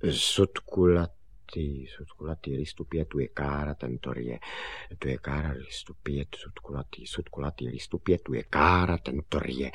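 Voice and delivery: soft, low muttered rote; murmured memorising; saying it to himself. Acted. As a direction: run through the list under your breath. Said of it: "říkal si Honzík".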